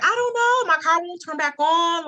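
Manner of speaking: high, flustered and embarrassed; nervous driver answering a stranger; flustered reply. Retold or imitated. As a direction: blurt it out, caught off guard. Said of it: "I'm like".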